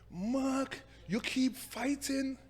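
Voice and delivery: high, slow Jamaican mom voice; Jamaican accent. Retold or imitated. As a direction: imitate his mother's Jamaican scolding. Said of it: "my Jamaican accent is kind of horrible".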